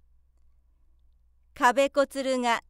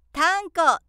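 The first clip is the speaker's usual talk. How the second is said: louder, higher cheeky yell; bellowed nickname; 6 semitones higher, 6 dB louder, slower than usual. A narrator's reading. Cheeky boy yells a nickname during roll call. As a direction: bellow it to the whole class. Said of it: "と怒鳴った".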